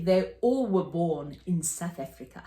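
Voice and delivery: a light South African accent imitation; mild imitation of South African cousins' accent. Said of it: "They talk like this".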